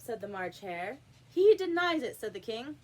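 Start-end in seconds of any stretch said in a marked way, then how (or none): 1.3-2.2 high, loud royal announcement; loud king's echo. A narrator's reading. King loudly repeats the Hare's denial. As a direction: proclaim it to the court.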